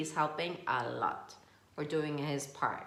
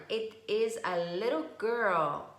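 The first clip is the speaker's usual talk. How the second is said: ordinary delivery, nothing marked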